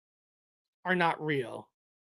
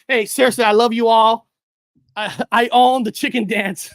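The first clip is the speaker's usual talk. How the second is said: loud and excited; 7 dB louder than usual. Real, not acted.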